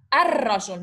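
"الرجل" with a long rolled R replacing the L; doubled R, no L. Shown as a demonstration. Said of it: "I didn't put L".

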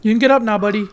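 normal talking voice

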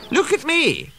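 very high, showy coo; self-satisfied purr. A narrator's reading. Daisy flaunts herself to waiting passengers, preening. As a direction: show yourself off to admirers. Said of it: "she purred to the waiting passengers".

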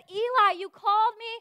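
put-on eager boy. Young Samuel running to the priest, eager.

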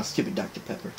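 husky throat-tickle voice. Real, not acted. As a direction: mutter through a scratchy throat. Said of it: "I got a tickle in my throat now".

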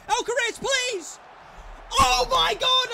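high, loud cry of despair; exasperated shout. Real, not acted. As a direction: yell in anguished disbelief. Despairing at missed chance.

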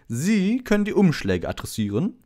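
high, loud, self-important teacher voice; a vain celebrity handing out chores; Lockhart voice. A narrator's reading. Lockhart orders Harry to address envelopes, grandly. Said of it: "wies Lockhart Harry an".

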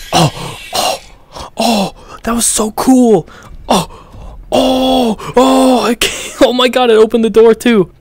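high, excited babbling, half whispered; can barely get the words out for excitement; thrilled. Real, not acted. Thrilled at the puzzle.

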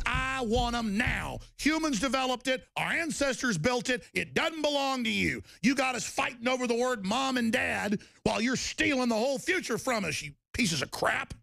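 high, impassioned tirade; worked-up protest in a high voice; angry demand. Real, not acted. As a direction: demand it with the pitch pushed right up.

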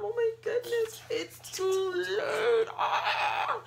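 high, loud wail; dismayed shout. Acted. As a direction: cry out in mock despair. Tacos eaten, shouting.

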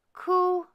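put-on a hidden baby ghost cooing. A narrator's reading. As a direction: coo like a contented baby. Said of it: "were startled to hear quite plainly".

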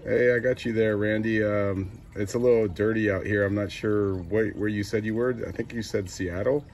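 ordinary talk

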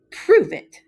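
high, loud defiant dare; defiant challenge. A narrator's reading. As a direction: throw down the challenge cheekily. Chickens challenging the cows.

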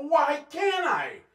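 high, loud, drawn-out acted retort; arguing back. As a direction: shout back at your mother in protest. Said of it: "we'd argue".

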